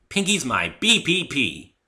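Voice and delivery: proud. Acted. Dash hugs Pinkie proudly.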